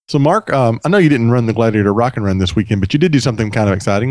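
normal talking voice

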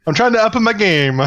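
high, hammed-up Italian accent; mock Italian, sung high; fake Italian accent. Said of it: "when you do the fake Italian accent".

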